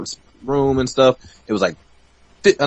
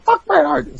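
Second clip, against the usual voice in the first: mock furious retort; slower than usual. Promoter dismissing Bret Hart, high mock fury. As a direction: bite back in outrage. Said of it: "It's like".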